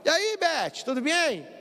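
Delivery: high, bright-toned phone greeting; cheery caller. Retold or imitated. Caller teasing Beth on the phone, cheerful high greeting. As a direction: chirp a playful hello to Beth on the phone. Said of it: "A pessoa liga no seu celular".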